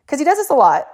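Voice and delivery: ordinary delivery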